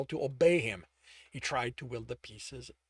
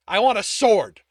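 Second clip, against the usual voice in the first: louder, insistent, pressing on want; stubborn demand; 7 semitones higher, 15 dB louder than usual. Acted. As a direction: demand it like a sulking child.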